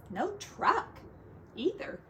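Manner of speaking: ordinary delivery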